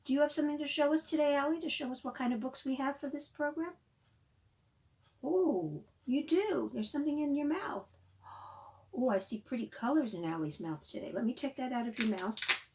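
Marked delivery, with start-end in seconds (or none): none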